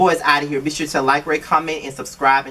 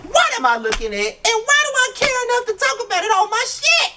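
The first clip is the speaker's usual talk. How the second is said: much higher voice, incredulous; exasperated disbelief. Retold or imitated. Vlogger reacting to the show in high, incredulous disbelief. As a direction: squeal it out in disbelief. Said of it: "I'm like".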